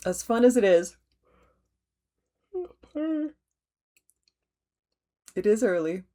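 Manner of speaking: words stretched out through a yawn; yawning mid-sentence. Real, not acted. Host yawning through her stitching update on video. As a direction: fight a yawn while you talk. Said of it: "the nerves that make me yawn".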